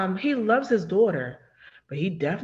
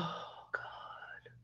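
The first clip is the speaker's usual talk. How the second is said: barely audible, all breath; gasp, then whisper in dismay; 21 dB quieter than usual. Real, not acted. Dismayed reaction to the plot.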